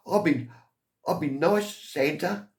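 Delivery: put-on high, eager little-boy reply. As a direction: answer Santa like a keen small boy. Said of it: "this little boy's waving to me".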